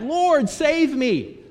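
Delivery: high, very slow plea; cry for help. Acted. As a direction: drag out a desperate cry to be saved. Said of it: "He cried out".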